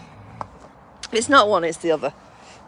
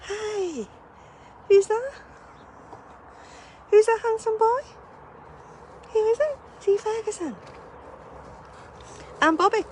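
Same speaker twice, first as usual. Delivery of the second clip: much higher, sing-song coo; 14 semitones higher than usual. Real, not acted. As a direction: tease the dog with a cooing question. Cooing at dog in window.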